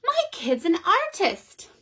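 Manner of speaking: high, amazed wow; delighted mother marvelling; amazed mom. Acted. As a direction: gush like a proud mom. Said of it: "She said".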